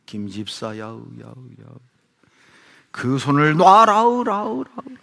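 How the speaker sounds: slow booming call with echo trailing off; mock divine echo, loud on 그 손을 놔라우; echoing voice of God. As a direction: play God calling from above, echo fading. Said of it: "음성이 들리더래요".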